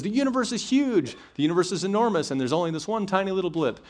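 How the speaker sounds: hammed-up stand-up exclaiming; Eddie Izzard rant. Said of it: "He says".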